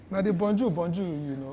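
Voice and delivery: loud, high teasing over Bonjour; mock his one French word loudly; teasing laugh. Real, not acted. Friend teases him loudly for knowing only one French word.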